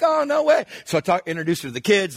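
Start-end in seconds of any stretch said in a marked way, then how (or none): 0.0-0.6 high, louder surprised yelp. Retold or imitated. Pastor meets waitress from his church, surprised. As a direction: act delighted to meet her. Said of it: "I'm like".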